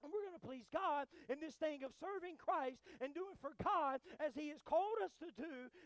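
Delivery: emphatic pulpit delivery; preaching. Real, not acted. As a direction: drive each phrase home to the congregation. Preaches at full force.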